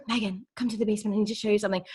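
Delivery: soft, quick beckoning mom voice; secretive mom. Retold or imitated. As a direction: mom calling her down, hushed and hurried. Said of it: "she's like".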